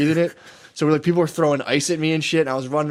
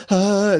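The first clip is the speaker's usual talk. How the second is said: high, louder mock cry; freaked-out yell; 7 semitones higher, 5 dB louder than usual. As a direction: yelp like a kid freaking out. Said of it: "was like".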